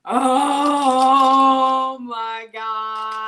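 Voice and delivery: long drawn-out, loud gasp of amazement; awestruck delight. Real, not acted. Host opening the box of food, awestruck delight. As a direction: stretch it out in big, loud wonder.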